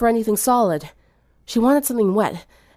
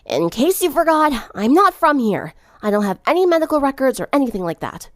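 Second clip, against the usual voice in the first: raised, defensive Sunset voice; stubborn patient; 6 semitones higher, 3 dB louder than usual. Acted. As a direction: push back, a bit higher than the narration.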